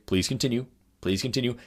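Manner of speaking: imitating an unmoved experimenter; calm, quiet insistence. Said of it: "the experimenter would just say".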